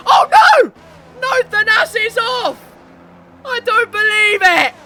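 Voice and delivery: very high, loud disbelieving shout; shocked outcry. Real, not acted. Commentator seeing Thanasi go off, shocked high cry. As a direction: wail in disbelief at the crash. Commentator sees leader's rival crash off.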